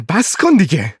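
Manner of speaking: put-on sharp angry yell at the baby; shout. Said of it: "فریاد زد". (فریاد کلافه سر کودک)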